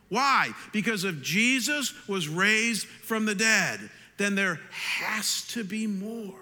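high, slow, weighty proclamation; emphatic preaching. Acted. Preacher drives home resurrection point.

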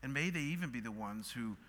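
hushed, earnest address to God; pastoral prayer. Real, not acted. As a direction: lead the congregation quietly in prayer. Leads closing prayer.

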